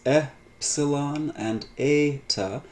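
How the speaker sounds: slow teaching contrast of epsilon and eta; contrast demo. Shown as a demonstration. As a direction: lay epsilon and eta side by side, slowly. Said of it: "reflect the difference in this pronunciation".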